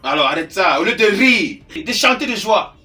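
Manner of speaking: loud, exasperated reprimand; loud scolding. Acted. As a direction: order the crying to stop, forcefully. Uncle scolds the crying niece. (réprimande à voix forte)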